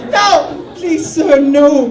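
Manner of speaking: high, loud desperate begging; pleading panic. Retold or imitated. Comic begging his stoned friend not to leave, high and loud. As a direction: beg him not to make you go. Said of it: "I'm like".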